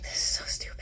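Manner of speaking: a dismissive whisper; whispered scorn. Retold or imitated. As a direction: hiss it under your breath. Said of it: "And I was like".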